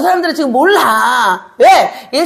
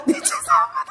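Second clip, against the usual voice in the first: meek apology; 7 dB quieter than usual. Pretend. Mock meek apology to Jesus.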